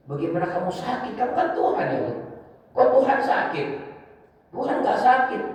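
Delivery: high-pitched baffled questioning; puzzled protest, pitch jumping right up; bewildered crowd. Retold or imitated. Bewildered people on Judgment Day question God, high puzzled voice. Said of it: "mereka bingung".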